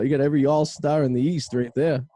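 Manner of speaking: ordinary delivery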